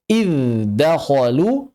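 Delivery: "idz dakhalu" slow, dz sounded before the d; unmerged "idz dakhalu"; clear izhar. Shown as a demonstration. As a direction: hold the buzz in "idz", then say "dakhalu".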